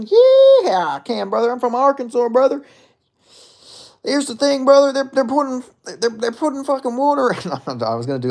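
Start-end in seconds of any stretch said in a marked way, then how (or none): 0.0-2.6 exaggerated country-boy voice, pitched way up; Bryce Mitchell. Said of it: "Can you do a Bryce Mitchell impression?". 4.0-7.5 hammed-up Southern impression, stumbling over the words; Bryce Mitchell. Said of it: "with my Bryce Mitchell impression".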